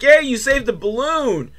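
high-pitched mock cheer; excited crowd. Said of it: "everyone's excited".

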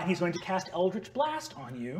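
ordinary delivery

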